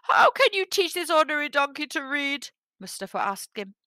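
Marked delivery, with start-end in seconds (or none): 0.0-2.5 high-pitched, anxious villager; very frightened. A narrator's reading. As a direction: ask it high, in worried disbelief. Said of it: "were very frightened".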